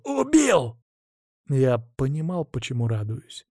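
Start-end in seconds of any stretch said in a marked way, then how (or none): 0.0-0.8 high, exultant shout of triumph; joyful cry. Acted. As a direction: burst out in wild glee. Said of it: "В голове бушевала чужая мне радость".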